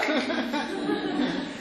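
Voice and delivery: breathy chuckle trailing on; laughing. Real, not acted. Rabbi amused by his once-a-year greeting, real chuckle. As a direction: laugh at your own joke.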